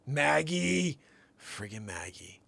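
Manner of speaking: ordinary talk, nothing marked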